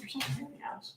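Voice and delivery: whispered teasing insult; whispered grumble. Real, not acted. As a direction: breathe the insult under your breath. Teasing friend after she moved doll.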